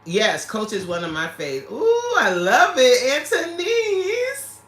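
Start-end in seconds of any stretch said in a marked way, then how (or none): 1.7-4.6 high, loud, drawn-out squeal of delight; slow, high-pitched gush. Real, not acted. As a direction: stretch it out in an adoring sing-out. Gushing over a viewer's comment.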